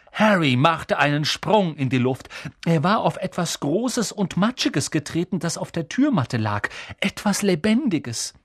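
plain reading voice

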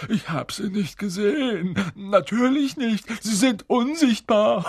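high-pitched grovelling poltergeist; servile, oily pleading; slimy. A narrator's reading. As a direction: apologise abjectly, with a nervous fawning laugh. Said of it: "sagte er schleimig".

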